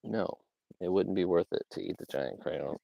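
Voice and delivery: ordinary talk, nothing marked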